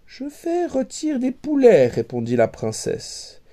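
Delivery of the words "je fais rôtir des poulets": high, prim reply; princess voice. A narrator's reading. Princess to Jean, high and prim. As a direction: answer with royal poise.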